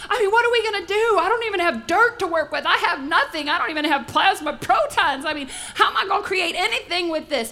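panicked, high, loud cries of alarm; mock-panic, as if lost in the dark; freaking out. Preacher shows the crowd how we freak out, in hammed-up panic. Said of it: "freak out like we would".

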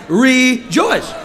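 loud shouted rejoice; congregation shout. Shown as a demonstration. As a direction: shout it with the crowd. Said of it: "Everybody shout".

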